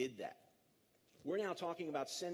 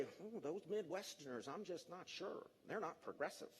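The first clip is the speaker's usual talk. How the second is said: much softer, sniffy doubtful murmur; mock smug liberal; 8 dB quieter than usual. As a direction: mimic a snooty coastal liberal under your breath. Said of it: "if you're a thoroughbred liberal ... and say".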